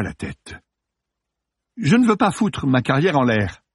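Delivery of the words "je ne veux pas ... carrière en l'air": high, worried protest; anxious pleading. Acted. Terrified actor to policeman about his career, anxious. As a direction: plead with the voice raised.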